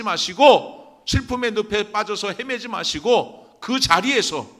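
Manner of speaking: high, loud exhortation; full-force preaching. Real, not acted. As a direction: drive it home to the congregation at the top of your voice. (힘차게 외치는 설교)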